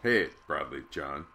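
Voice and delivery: normal talking voice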